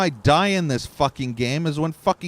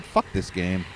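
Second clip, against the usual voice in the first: ordinary talk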